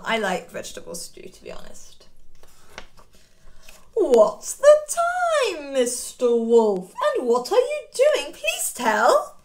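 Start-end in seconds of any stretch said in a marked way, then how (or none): 4.0-9.4 high, slow drawn-out call; singsong question. Pretend. Children asking the wolf the time, high singsong. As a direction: call out the question like a playground game.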